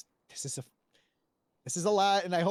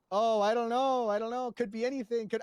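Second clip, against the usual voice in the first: higher, louder mock bafflement; put-on cluelessness; 6 semitones higher, 3 dB louder than usual. Retelling the refusal to give a statement, mock cluelessness. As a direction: act out an exaggerated know-nothing routine. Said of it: "when I started doing the whole".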